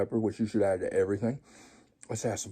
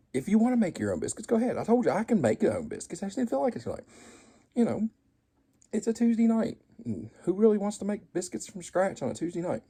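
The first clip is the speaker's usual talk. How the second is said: mock defensive; 9 semitones higher than usual. High defensive put-on voice.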